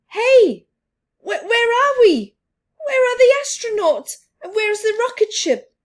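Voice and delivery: high, loud, quick bewildered calls; confused surprise. Acted. Children waking at home, searching for rocket, bewildered. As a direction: sound lost and startled.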